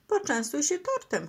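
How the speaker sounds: a touch of tearfulness; mild sniffling. A narrator's reading. Turtle offers cake at the farewell, mildly tearful. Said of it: "chlipnął Franklin".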